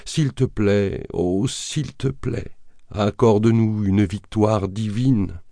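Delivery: slow, pleading prayer, pitch lifted; earnest supplication read in character; fervent prayer. A narrator's reading. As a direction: implore the Lord, drawing out each plea. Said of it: "et prièrent comme jamais".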